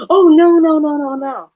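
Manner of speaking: high, loud mock scammer protest; a hammed-up scammer backpedaling; mock scammer panic. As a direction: play the caught scammer squealing no. Scammer voiced denying.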